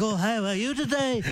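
mock English accent, hammed-up and garbled; thick, badly faked English talking voice. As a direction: do a bad impersonation of an English accent. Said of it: "but when they talk, they're like".

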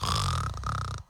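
long, low snoring breath; imitated dozing grandpa. Grandson imitating grandpa asleep during the Braves game, heavy sleeping breath. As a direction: breathe out heavily like someone asleep on the couch. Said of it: "This dude be like".

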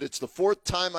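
ordinary delivery

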